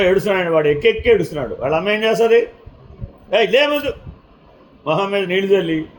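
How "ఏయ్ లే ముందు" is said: sharp higher call to get up; mother waking son. Retold or imitated. Mother shaking her dreaming son awake, high urgent call. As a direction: jolt the sleeping boy awake.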